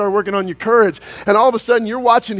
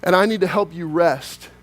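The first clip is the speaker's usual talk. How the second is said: tender voice of God; 5 semitones lower than usual. Pretend. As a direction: speak gently, as God to a tired son. Voicing God speaking to Matt.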